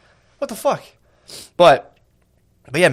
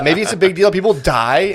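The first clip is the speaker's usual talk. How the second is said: higher-pitched mocking teen-girl sass; nineteen-year-old chick; 5 semitones higher, 4 dB louder than usual. Mayor brushing off job losses, mock teen-girl sass. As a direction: imitate the mayor as a sassy young woman. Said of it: "stop talking like a nineteen-year-old chick".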